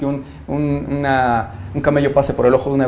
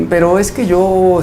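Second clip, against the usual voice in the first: higher, drawn-out mock excuse; excusa titubeante imitada; 6 semitones higher, 4 dB louder, slower than usual. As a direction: stall reluctantly, imitating an excuse-maker. Imita a quien pone excusas. (excusa fingida, aguda y alargada)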